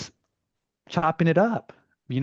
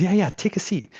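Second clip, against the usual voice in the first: eager host; 8 semitones higher, 3 dB louder than usual. Retold or imitated. Imitating the kid's eager offer.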